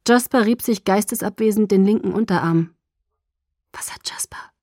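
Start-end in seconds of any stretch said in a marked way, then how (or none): 3.7-4.5 very soft whispered question; hushed, voiceless whisper. A narrator's reading. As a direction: whisper it under your breath. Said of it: "flüsterte ich".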